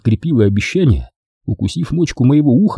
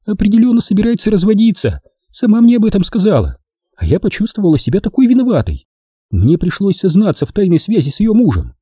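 put-on higher young woman's voice, teasing; Дженни; 9 semitones higher than usual. A narrator's reading. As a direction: play the girlfriend, sweet and teasing. Jenny voiced. (нежная игривая Дженни)

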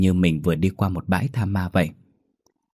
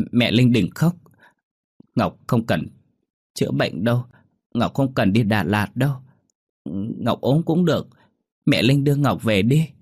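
put-on higher, childlike pleading; little girl voice; 4 semitones higher than usual. Sick child begs her mother not to cry, childlike. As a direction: speak as a small girl comforting mum.

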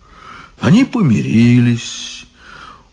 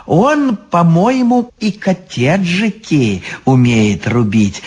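high crone interjecting; Baba Yaga voice; 8 semitones higher than usual. Acted. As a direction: cut in as an old witch. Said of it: "встряла Баба Яга".

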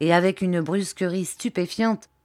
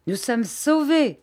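higher, slow relieved cheer; optimistic relief; 7 semitones higher than usual. A narrator's reading. Bobby cheering in optimistic relief. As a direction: savour the good news. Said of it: "d'humeur optimiste".

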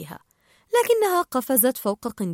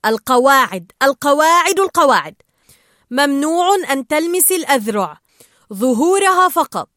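loud, insistent calling to order; commanding; 4 semitones higher, 8 dB louder than usual. A narrator's reading. Marlin laying down the game's rules, loudly commanding. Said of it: "أمرها مارلين".

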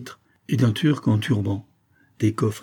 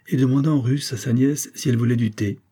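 ordinary delivery, nothing marked

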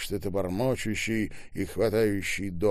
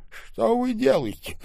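high, raised, alarmed question; hissed reproach; 6 dB louder than usual. A narrator's reading. Штаубен turning pale at Ребров, alarmed reproach. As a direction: demand it in a high strained voice. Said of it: "зашипел побледневший Штаубен".

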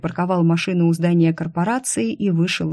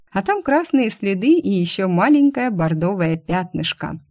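ordinary delivery

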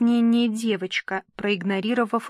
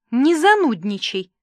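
higher, louder rebuke; irritated scolding; 4 semitones higher, 6 dB louder than usual. A narrator's reading. Papa with flashing eyes tells her off, irritated. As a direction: snap it in mild annoyance.

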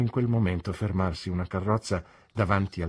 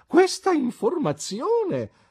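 much higher, slow, louder outraged echo; indignant old lady; 7 dB louder, slower than usual. A narrator's reading. Mother indignantly echoes her daughter's words. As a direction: throw the words back in affront. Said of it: "ripeté missis McLean".

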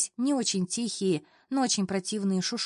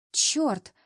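heartfelt high-pitched curse; with feeling; 5 semitones higher, 3 dB louder than usual. A narrator's reading. Coworker reacting to the news, heartfelt exasperated curse. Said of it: "с чувством произнесла Лиза".

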